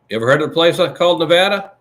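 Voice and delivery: loud, fast mock Trump; Trump impression. Host quoting Trump in Nevada, loud and fast. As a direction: bark it like Trump at a rally. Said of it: "Trump went to Nevada and he says".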